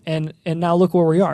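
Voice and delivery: ordinary talk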